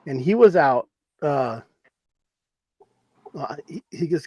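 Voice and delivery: normal talking voice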